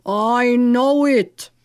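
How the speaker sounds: very slow, drawn-out captive's voice; captive Turk. A narrator's reading. Captive Pasha answering the knight, slow character voice. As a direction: answer with long, heavy stretched words. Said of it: "replied the Turk".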